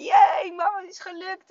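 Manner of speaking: put-on high-pitched child's jubilant cheer; imitated kid celebrating a win. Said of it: "En helemaal juichen".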